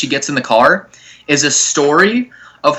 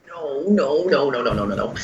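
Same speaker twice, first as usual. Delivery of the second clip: fading no's; 3 semitones higher, 7 dB quieter than usual. Retold or imitated. Refusing, voice fading out.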